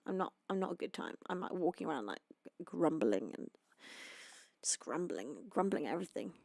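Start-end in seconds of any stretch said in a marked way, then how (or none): 2.6-6.3 lower, softer grumbled words; a hint of grumpy muttering; lightly grumbling. Shown as a demonstration. Showing viewers how she grumbles when tired, mildly. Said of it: "inaudible grumbles under my breath".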